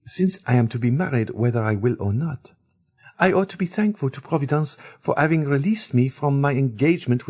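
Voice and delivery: a hint of a cool, proud heiress; girl tells the ladies her escape, lightly voiced and proud; Eugénie speaking. A narrator's reading.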